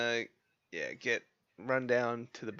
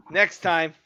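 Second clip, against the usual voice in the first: taunting; 6 semitones higher, 13 dB louder than usual. Acted. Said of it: "I look at him and say".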